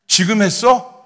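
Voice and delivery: loud, high teasing question; amused mock surprise. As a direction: tease a junior as if he's late to it. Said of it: "막 웃으시는 거예요". (선배가 놀리듯 크고 높게 묻기)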